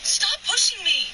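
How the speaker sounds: high, loud, cracking with distress; begging her to stop, voice strained high and loud; desperate yell. Real, not acted. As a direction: shout like you're backed into a corner. Partner cornered in argument.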